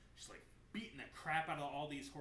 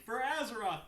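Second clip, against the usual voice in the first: loud, higher rallying shout; battle cry; 8 semitones higher, 12 dB louder than usual. Retold or imitated. Saurfang charging Sylvanas, a defiant battle cry. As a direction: roar it as a war cry.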